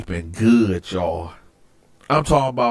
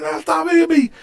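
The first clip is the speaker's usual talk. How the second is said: high-pitched mock protest; panicked begging. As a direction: imitate the cellmate pleading. Cellmate begs again.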